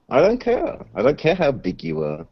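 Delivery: ordinary talk, nothing marked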